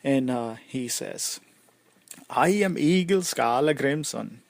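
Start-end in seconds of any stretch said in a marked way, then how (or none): 2.3-4.4 put-on proud, louder Swedish-accented introduction. Warrior offering a handshake to strangers, Swedish accent. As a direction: announce your name in a put-on Swedish accent. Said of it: "sorry, that was my Swedish, uh, accent".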